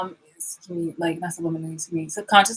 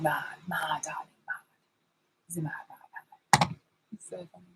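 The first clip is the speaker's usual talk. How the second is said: whisper; 3 semitones lower, 10 dB quieter, faster than usual. Retold or imitated. Whispered mockery.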